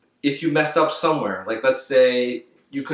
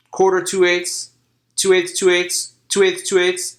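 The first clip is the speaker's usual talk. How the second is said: loud and higher; almost yelling the counts; loud forte; 8 semitones higher, 4 dB louder than usual. Shown as a demonstration. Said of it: "forte is nice and loud".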